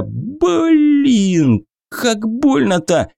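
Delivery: high, pained yelp as the arrow comes out; pain outcry. Acted. Hero yelps as a veteran yanks the arrow out, high pained cry. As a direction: cry out in sharp pain, dragging the second half.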